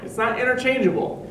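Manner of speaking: ordinary delivery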